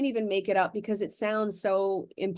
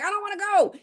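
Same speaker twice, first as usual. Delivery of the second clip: put-on much higher, a bit louder, childish whine; whiny kid; 13 semitones higher, 4 dB louder, faster than usual. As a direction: plead like a kid who won't go. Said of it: "I'm like".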